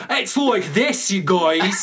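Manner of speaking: loud, high, overdone Scottish; hardcore Scottish accent. Retold or imitated. Mocking actors who go hardcore, overdone Scottish accent. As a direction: lay the Scottish on thick and slow. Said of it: "go hardcore for the Scottish accent".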